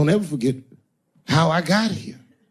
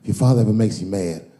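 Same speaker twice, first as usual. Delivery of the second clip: lower, sly and pointed; sly Kevin; 8 semitones lower than usual. Retold or imitated. Kevin jabs at Dave through the son, low and sly. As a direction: drop it low like a jab. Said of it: "He goes".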